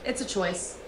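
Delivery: usual speaking voice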